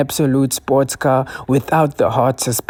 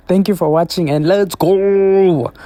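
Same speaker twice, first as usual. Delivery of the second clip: hype shout; 4 semitones higher than usual. Real, not acted. Hyping up the outro.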